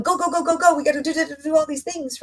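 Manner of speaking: mock frantic rush. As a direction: rattle it off like someone who can't stop. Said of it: "Instead of going".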